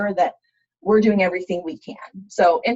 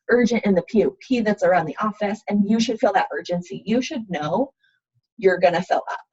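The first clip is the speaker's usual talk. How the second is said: usual speaking voice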